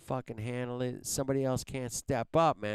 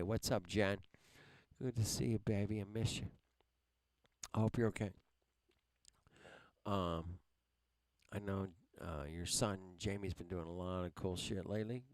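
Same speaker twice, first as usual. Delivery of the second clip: softer, gentler to a friend; tender greeting; 4 semitones lower, 8 dB quieter, faster than usual. Real, not acted. Streamer switching from rant to greet friend Jen, soft and tender. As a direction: soften for someone you miss.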